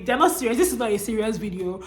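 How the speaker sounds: ordinary talk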